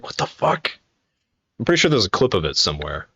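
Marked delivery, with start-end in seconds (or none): 0.0-0.7 breathy stunned whisper; hushed shock. Retold or imitated. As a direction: whisper it in disbelief. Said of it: "And I was like".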